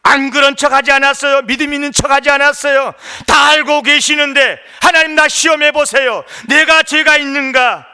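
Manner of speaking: high, loud, impassioned pulpit delivery; full-force preaching. Acted. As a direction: preach it forcefully at the top of your range.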